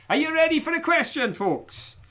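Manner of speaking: rally the crowd before the quiz; calling out. Real, not acted. Host rallies viewers before quiz.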